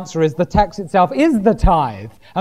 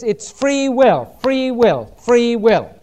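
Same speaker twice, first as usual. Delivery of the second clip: "free will" drawn out slowly, higher, falling each time; emphatic repetition; 4 semitones higher, slower than usual. Shown as a demonstration. Preacher pointing out the law of giving, "free will" stressed emphatically. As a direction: hammer home "free will", stretching each one. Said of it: "notice the emphasis".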